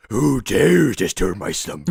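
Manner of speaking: low, slow and loud rasping voice; a slow, booming, ancient menace; ancient rasping undead king. Pretend. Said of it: "verged on Batman".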